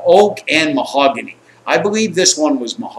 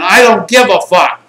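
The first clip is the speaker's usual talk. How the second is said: mock defiance; 4 semitones higher, 6 dB louder than usual. Acted defiant answer during a confrontation.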